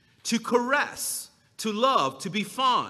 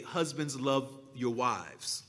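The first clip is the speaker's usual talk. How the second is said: soft solemn quote; 7 semitones lower, 9 dB quieter than usual. Shown as a demonstration. Quoting Paul's command.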